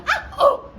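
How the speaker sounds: two loud, acted moans in different tones; practice moans. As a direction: demonstrate moans in varied keys. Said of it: "moaning in various keys and various tones".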